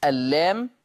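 ordinary talk

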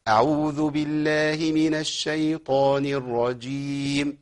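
أعوذ بالله من الشيطان الرجيم recited very slowly with the letters clear of any nasal hum; slow, clean tajweed with no added nasal hum; clean recitation, no nasal. Shown as a demonstration. Teacher showing students the correct recitation, slow and clean. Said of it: "تاني".

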